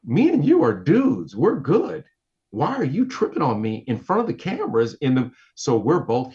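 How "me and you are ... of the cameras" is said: higher, louder indignant appeal to a buddy; worked-up, punchy challenge; retold pissed-off protest. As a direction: replay your heated protest at a friend. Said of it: "so now I'm pissed".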